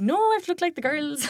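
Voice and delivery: put-on high, pleading young girl; whiny teenage protest. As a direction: protest like a stroppy teen. Teen self protesting to mom.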